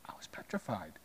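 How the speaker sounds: breathy hush of remembered fear; hushed whisper. Retold or imitated. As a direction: whisper it as if still scared. Pastor recalls fear, whispered.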